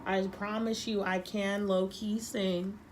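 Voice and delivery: ordinary talk, nothing marked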